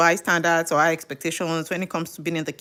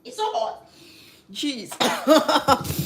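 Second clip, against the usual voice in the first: choking on pepper; 7 semitones higher, much slower than usual. Real, not acted. Cook overcome by hot pepper, choking. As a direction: squeeze it out after a real cough.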